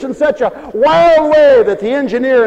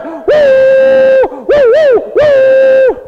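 high loud whistle blasts, one after another; train whistle woos; 14 semitones higher, 5 dB louder, much slower than usual. Acted. The train reaches the oak tree, loud high whistle blasts.

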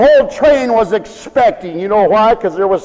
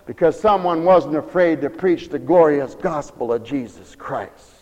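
hushed reverence; 8 semitones lower, 6 dB quieter than usual. Real, not acted. Preacher's hushed emphasis.

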